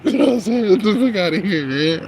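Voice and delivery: laughing, high and loud; giggling question. Real, not acted.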